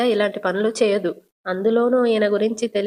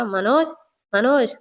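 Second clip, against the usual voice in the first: angry call; 2 semitones higher, slower than usual. A narrator's reading. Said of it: "Rohini మనోజ్ని చూసి కోపంగా".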